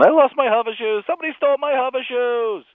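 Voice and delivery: high, whiny sitcom complaint; high indignant Seinfeld whine; Jerry Seinfeld voice. Acted. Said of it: "it's Jerry comes in".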